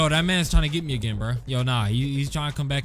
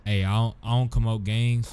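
normal talking voice

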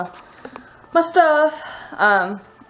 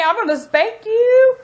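mock threat; 12 semitones higher, 4 dB louder than usual. Mom scolding toddler, mock threat.

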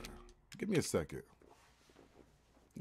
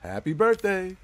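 rising to a loud, high cheer; excited build-up; 3 semitones higher, 10 dB louder than usual. Real, not acted. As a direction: hype it up, building to a shout. Hyped countdown to lighting the candle.

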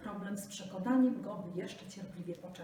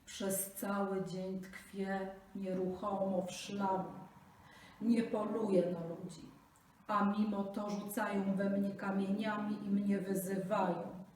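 low crocodile voice; 7 dB louder than usual. Acted. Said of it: "swoim niskim głosem".

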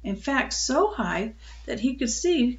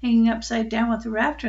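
ordinary delivery, nothing marked

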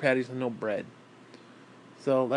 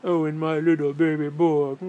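louder put-on doting mother voice; imitating movie mother; 5 dB louder than usual. Man quoting Throw Mama from a Train, doting mother voice. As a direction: coo like the movie mom.

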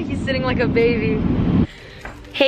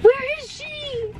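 high, slow and softer whimper; slow, quiet, high puppy whimper; 13 semitones higher, 4 dB quieter, slower than usual. Pretend. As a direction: whimper it as the sad little dog. Dog asking again, softer.

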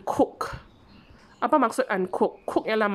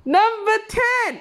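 announcing the item; 12 semitones higher, 5 dB louder, slower than usual. Shown as a demonstration.

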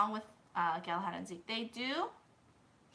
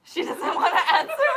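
laughing tease; 9 dB louder than usual. Real, not acted. Teasing guest.

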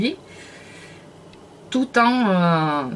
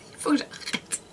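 softer, whispered with a laugh; whispered aside; 8 semitones higher, 8 dB quieter than usual. Real, not acted. Laughing at herself for copying other vloggers, whispered. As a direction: hush it to yourself through a laugh.

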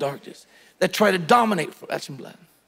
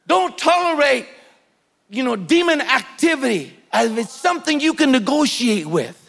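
loud, rising preacher exhortation; fiery sermon exhortation; preaching at full force; 5 semitones higher, 3 dB louder than usual. Acted. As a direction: drive it home from the pulpit.

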